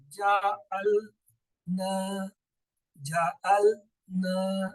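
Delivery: جعلنا said very slowly, the naa held long; very slow drawn out. Shown as a demonstration. Teacher slowly stretching جعلنا for the class. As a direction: draw out جعلنا syllable by syllable. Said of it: "اس نون کو اتنا کھینچ کے ظاہر کر رہے ہیں".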